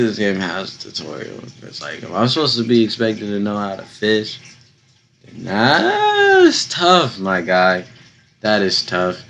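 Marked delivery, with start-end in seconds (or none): 5.3-7.2 high squeaky voice. Real, not acted. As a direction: jump way up in pitch. Reacting to fishing tutorial.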